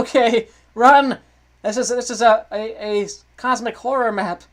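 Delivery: high, loud cry, stretched at first; panicked shouting. Pretend. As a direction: yell like you're fleeing a monster. Panicked flight in horror game.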